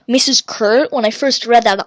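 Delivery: ordinary talk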